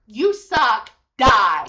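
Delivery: loud, blunt mock insult, rising in volume; mock harsh insult. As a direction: throw it like a hater's comment. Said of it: "I hear".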